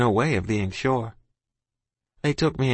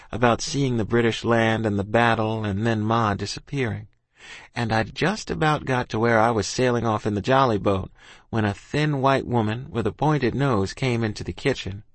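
ordinary talk

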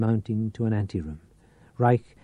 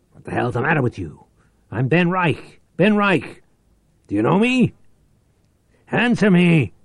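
higher, louder angry yelling; furious demand; 8 semitones higher, 6 dB louder than usual. Acted. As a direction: bark at him to answer. Reich yells at silent old man.

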